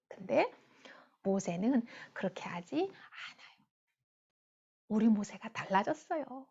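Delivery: usual speaking voice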